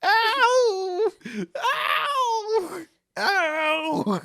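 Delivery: high, loud laughing imitation of a yowling cat; mock cat yowl. Imitating the cat's screams.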